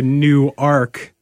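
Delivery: Newark said as New Ark, two full words; Delaware pronunciation. Shown as a demonstration. Host explaining the Delaware town, Newark as "new ark". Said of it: "you're reprimanded for".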